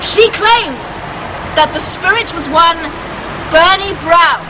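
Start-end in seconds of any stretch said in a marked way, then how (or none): none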